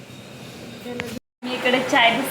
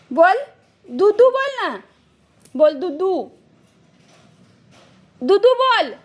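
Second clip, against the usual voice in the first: put-on higher singsong voice coaxing a toddler; coaxing baby talk; 4 semitones higher than usual. Mother coaxing her toddler to say dudhu, in a high singsong. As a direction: lilt each line up to get the baby to talk.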